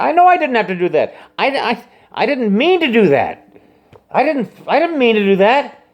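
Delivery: high, fast defensive pleading to an angry friend. Retold or imitated. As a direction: plead your innocence in a high rush.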